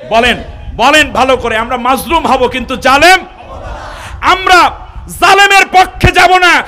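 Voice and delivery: high, very loud rallying shout; fiery sermon climax, shouted pledge; impassioned preaching. Real, not acted. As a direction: rouse the congregation at the top of your voice. Rallies congregation at full force.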